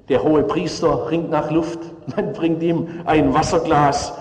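normal talking voice

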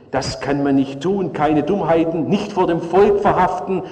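ordinary delivery

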